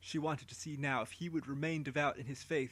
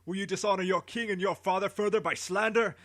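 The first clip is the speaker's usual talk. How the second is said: louder, higher furious royal scolding; angry rebuke; 5 semitones higher, 7 dB louder than usual. Acted. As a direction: rage down at the prisoner. Nimrod rebukes Haran.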